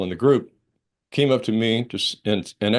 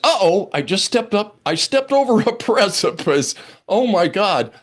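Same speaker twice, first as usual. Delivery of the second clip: startled alarm; 8 semitones higher, 4 dB louder than usual. Retold or imitated. Realizing he stepped over precipice.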